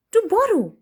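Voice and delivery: high, disbelieving question; can you believe it; incredulous. Acted. Storyteller doubting the thief, high and incredulous.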